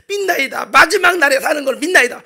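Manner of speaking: high, loud profession of faith; acted earnest avowal. Martha answers Jesus' question, acted earnest avowal.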